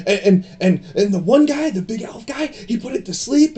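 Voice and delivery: put-on high, eager kid voice; excited boy king. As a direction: chatter like an eager little boy. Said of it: "Grover will be like".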